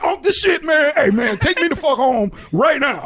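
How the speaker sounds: retold heated protest; retold mad outburst. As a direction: act out angrily insisting on leaving. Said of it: "I was so fucking mad".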